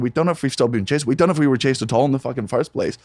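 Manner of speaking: ordinary talk, nothing marked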